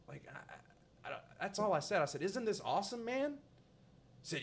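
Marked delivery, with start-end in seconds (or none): 2.2-3.3 repeating what he actually said; innocent enthusiasm. Retold or imitated. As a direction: say it all innocent. Quoting his own line again.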